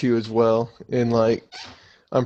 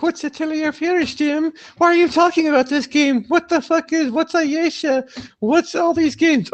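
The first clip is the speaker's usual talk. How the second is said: very high, squeaky mock-clueless voice; baffled listener. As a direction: pepper them with questions in a silly high-pitched voice. Voices clueless listeners asking about games.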